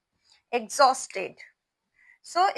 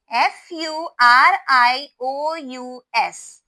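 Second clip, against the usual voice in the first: slow loud spelling; 8 dB louder, slower than usual. Shown as a demonstration. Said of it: "spells the word aloud".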